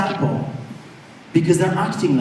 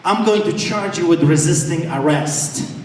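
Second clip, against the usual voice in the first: normal talking voice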